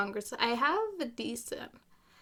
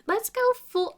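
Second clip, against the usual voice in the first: high put-on advisor voice, suddenly higher; 11 semitones higher, 4 dB louder than usual. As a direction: launch into a silly official voice. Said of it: "oh, my voices!".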